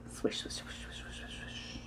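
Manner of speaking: rapid whispered swishes; hushed, whirling water sounds; fast swishes. A narrator's reading. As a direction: run the swishes together under your breath. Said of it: "went in fast circles".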